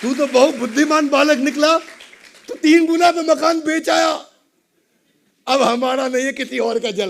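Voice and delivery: high, loud delighted exclamation; overjoyed praise. Acted. Father relieved his son sold the house, loud joyful praise. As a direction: gush with acted fatherly pride. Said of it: "बहुत खुश हुए".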